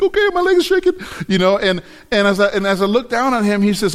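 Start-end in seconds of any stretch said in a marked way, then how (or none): 0.0-0.9 mock panic. Retold fear on the wall.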